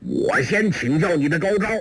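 high-pitched challenge; eager fighter's mild shout; calling out. A narrator's reading. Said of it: "捋起长衣叫道".

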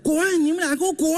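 high-pitched acted angry outburst; angry mother. Wife orders husband and neighbour out, angry outburst. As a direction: play a furious wife throwing them out. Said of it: "更生气了".

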